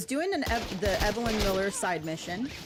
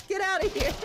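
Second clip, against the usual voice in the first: 1 laughing; 11 semitones higher than usual. Real, not acted. Laughing at NPC.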